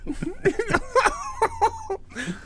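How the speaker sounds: high loud burst of laughter; real laughter at own joke. Real, not acted. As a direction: crack up laughing.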